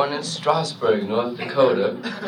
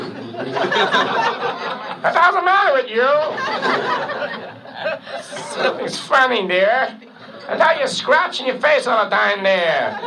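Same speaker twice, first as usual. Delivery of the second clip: high, shouted bandleader outburst; 9 semitones higher than usual. Acted. Bandleader snaps at the spaced-out trumpeter, shouting.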